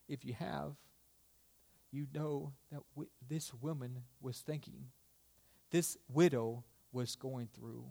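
soft, sombre pulpit questions; quiet, pause-laden sermon delivery; hushed preaching. Real, not acted.

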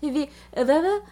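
fumbled, halting syllables; stumbling over a name. Shown as a demonstration. Podcast host showing her trouble with a name, stumbling. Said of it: "but here I am going".

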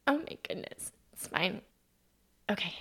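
fighting back tears quietly; real emotion catching the voice softly; overcome with emotion. Real, not acted. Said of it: "a little emotional".

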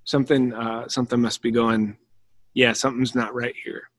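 soft, puzzled realization; quietly sense something is off; uneasy murmur. Retold or imitated. Crowd member noticing Jesus weep, soft and uneasy. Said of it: "you're like".

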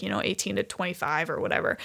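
normal talking voice

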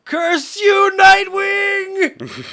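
shrieking high villain cry; villain shout. Acted. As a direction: scream it like a cartoon villain. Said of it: "pans back to the Madman".

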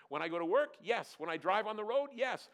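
high, loud rapid-fire self-answering; emphatic question and answer. Acted. Preacher asking and answering for the congregation, emphatic. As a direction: fire off each question and affirm it hard.

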